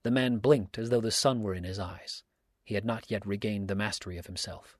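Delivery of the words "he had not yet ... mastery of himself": plain reading voice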